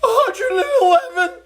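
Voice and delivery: high, slow, laughing shout; Norton's shocked cry. Retold or imitated. Norton reading Ralph's thermometer, a high laughing shout. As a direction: squeal the number like a cartoon alarm. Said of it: "Norton goes".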